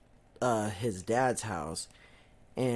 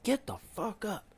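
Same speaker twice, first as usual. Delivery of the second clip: high, mock-furious dad barking at his son; angry dad; 10 semitones higher, 4 dB quieter than usual. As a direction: imitate a furious father waking you. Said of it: "like, he's pissed".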